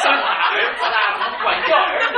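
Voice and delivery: high, loud words through laughter; laughing. Real, not acted. Pastor laughing to the congregation about raising a son.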